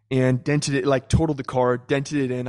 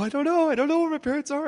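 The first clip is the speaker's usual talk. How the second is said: put-on much higher, panicked young voice; scared; 12 semitones higher, faster than usual. Shaken nineteen-year-old answering the angry driver, scared. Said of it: "I was so scared".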